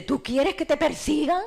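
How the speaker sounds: high, loud, slow probing question; a pastor asking loud and slow; pastor's challenge. Retold or imitated. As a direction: press her like a pastor testing her. Pastor questions her in church.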